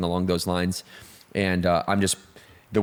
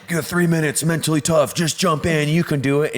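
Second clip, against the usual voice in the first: pep talk; 7 semitones higher than usual. Retold or imitated. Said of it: "I'm like".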